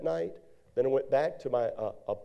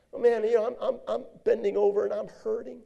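ordinary talk, nothing marked

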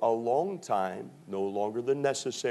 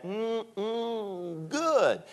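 savoring mmm good; 10 semitones higher, much slower than usual. Retold or imitated. Daddy demonstrating how to eat pizza, high savoring hum. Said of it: "he did that".

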